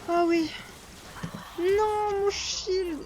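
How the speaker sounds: loud high cry of dismay. Real, not acted. Player losing her shield mid-fight, loud dismayed cry. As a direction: wail over the wasted shield.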